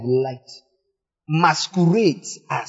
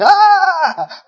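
high, loud mocking shriek "Ah!"; 6 dB louder than usual. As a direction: shriek in scornful mockery. Mocking careless people, shrieks.

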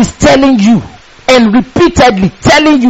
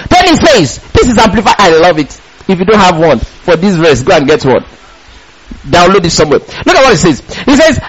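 ordinary talk, nothing marked

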